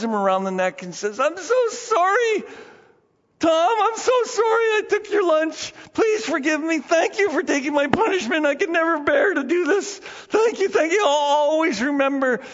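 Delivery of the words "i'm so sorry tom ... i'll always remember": put-on a small boy crying out his thanks and sorrow; sobbing child. Jimmy crying thanks to Tom.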